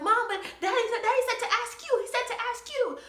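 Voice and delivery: put-on high, fast whiny child; frustrated daughter telling mom what dad said, high and whiny; frustrated kid. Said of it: "I would be so frustrated".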